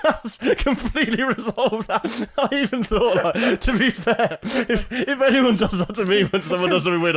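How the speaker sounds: very high, loud, laughing speech; helpless laughter. Real, not acted. Friend amazed at a resolved conflict, helpless laughter. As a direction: push the words out through real laughter.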